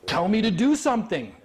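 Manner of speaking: high, emphatic imagined plea; acted-out eager appeal; mock urging. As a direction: urge the judge to give the word. Sheriff's silent plea to judge voiced.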